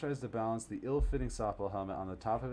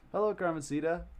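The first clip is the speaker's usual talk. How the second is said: cheery greeting; 9 semitones higher, 6 dB louder than usual. Acted. Greeting a child character.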